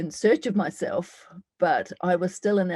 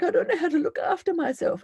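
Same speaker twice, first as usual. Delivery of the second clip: frightened self; 10 semitones higher than usual. Acted. Inner dialogue, her frightened self.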